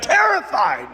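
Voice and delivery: slow, emphatic confession; wry admission. Retold or imitated. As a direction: stretch out terrified. Pastor admits fear.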